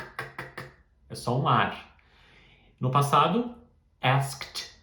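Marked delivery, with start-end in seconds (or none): 0.0-0.7 "k" as a whispered unvoiced puff of air; just air, "k, k", no voice; voiceless k. Shown as a demonstration. Teacher showing there is no vibration, unvoiced. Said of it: "É só um k".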